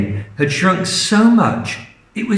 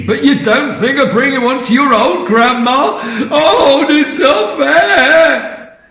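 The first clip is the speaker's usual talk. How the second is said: gloating Grandma; 8 semitones higher, 3 dB louder than usual. Acted. Grandma scolding the family over tea, gloating then whining. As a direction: accuse them, then whine. Grandma accusing, self-pitying.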